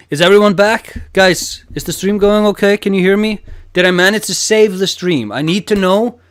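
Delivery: loud urgent questions to chat; anxious calling out. Real, not acted. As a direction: call out to chat, worried. Streamer checks if stream recovered.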